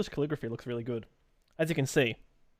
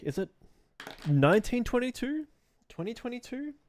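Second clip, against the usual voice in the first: puzzled aliens; 9 semitones higher than usual. Acted. Streamer imagines aliens reading the date, puzzled and high-pitched. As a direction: imitate baffled aliens, pitched well up. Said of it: "When aliens, um, read the shit".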